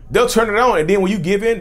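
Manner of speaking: ordinary talk, nothing marked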